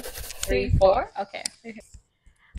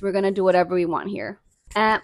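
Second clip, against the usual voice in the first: ordinary talk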